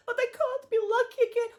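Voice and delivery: high, tearful pleading through sobs; crying. Acted. Locked-in girl despairs to herself, crying. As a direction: cry the words out, voice breaking. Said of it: "she just began crying".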